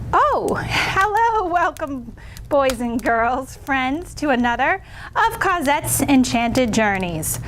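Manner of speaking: put-on slow singsong welcome to children; sweet storytime host greeting; kids show host.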